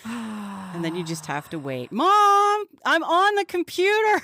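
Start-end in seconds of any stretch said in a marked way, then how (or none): 1.9-4.2 put-on kid yelling.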